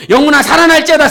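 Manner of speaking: full-force preacher's shout. Shown as a demonstration. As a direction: lead the congregation in a loud, high chant. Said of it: "이렇게 외쳤어". (회중을 이끄는 힘찬 외침)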